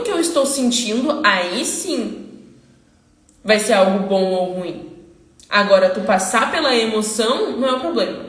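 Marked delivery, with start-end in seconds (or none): none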